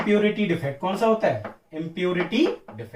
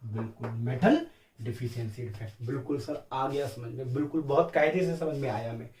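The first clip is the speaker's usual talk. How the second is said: a lower, softer student voice saying it's clear; pupil's quiet reply; 5 semitones lower, 6 dB quieter than usual. Pretend. Teacher voicing a pupil who understands, lower and softer. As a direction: play the satisfied pupil. Student voice agreeing after explanation.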